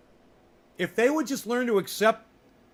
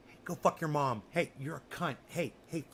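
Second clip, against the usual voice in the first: muttered mock insults, much softer; mock quiet insults; 5 semitones lower, 9 dB quieter than usual. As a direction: toss the insults off under your breath. Mock insults while pointing at names.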